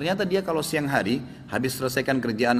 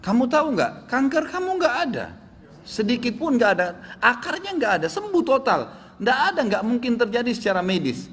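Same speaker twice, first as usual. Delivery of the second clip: much higher, amazed retold announcement; astonished doctor; 9 semitones higher than usual. Doctor tells patient her cancer is gone, astonished. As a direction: act out a doctor's disbelief, pitch leaping high.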